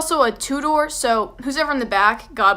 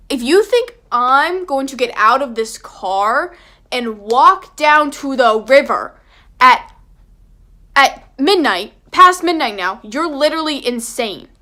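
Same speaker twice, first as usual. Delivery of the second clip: louder, slowed, each word driven home; outraged rant; 6 dB louder than usual. Retold or imitated. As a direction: tell them they're insane. Ranting refusal to friends.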